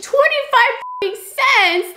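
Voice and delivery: high, slow disbelieving echo of the price; incredulous. Real, not acted. Vlogger repeats the cheap water price in disbelief. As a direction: drag it out in shock that it was so cheap.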